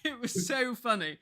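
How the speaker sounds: real laughter through the words; giggling at the memory; laughing. Real, not acted. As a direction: laugh as you tell it. Laughing at own story.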